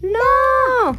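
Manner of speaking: high, long-held mock yell; mock shout. Family yells at dog over the newspapers, high mock shout. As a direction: hold the no high like a playful telling-off. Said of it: "¿Y que les gritan?".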